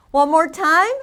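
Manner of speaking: usual speaking voice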